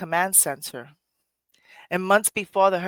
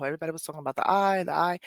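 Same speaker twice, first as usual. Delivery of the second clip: ordinary talk, nothing marked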